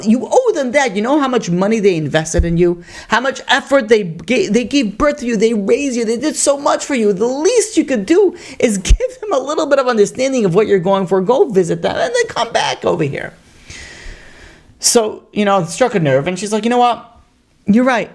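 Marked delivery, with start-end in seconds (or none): 0.0-13.3 brother pressing her, voice much higher; insistent pleading. Retold or imitated. Brother lectures sister about duty. 16.6-18.1 sister giving in, higher and brighter; sudden change of heart. Retold or imitated.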